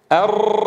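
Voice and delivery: أر with one tap, air squeezed through a narrow gap; one tight tap on أر; single tap r. Shown as a demonstration. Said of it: "وحدة بس".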